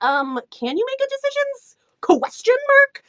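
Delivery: very high, slow questioning voice; mock queen. Reviewer mimics the clueless queen, high mock-questioning voice. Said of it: "the queen is just like".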